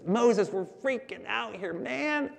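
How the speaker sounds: small, soft voice climbing high at the end; lightly acted nervous freak-out; mock panic.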